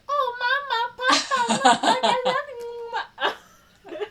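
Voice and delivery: put-on squeaky falsetto child, drawn out, dissolving in laughter; [name] posing as child. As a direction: badly fake a little kid on the phone. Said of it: "[name]. He's just like".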